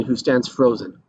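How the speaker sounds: ordinary delivery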